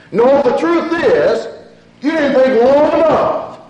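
high, forceful preaching. Real, not acted. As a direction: press the point home from the pulpit.

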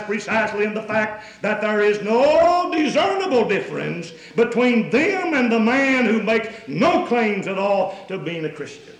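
loud, driving pulpit delivery; loud sermon voice; full-force preaching. Acted. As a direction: preach it out to the whole congregation.

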